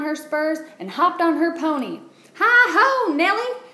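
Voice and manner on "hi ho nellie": high, loud galloping cry; shouted cowgirl call. A narrator's reading. Alice riding onto the playground, high loud shout. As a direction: call out to the pony, much higher and louder. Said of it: "she shouted".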